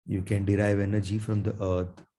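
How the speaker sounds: ordinary talk, nothing marked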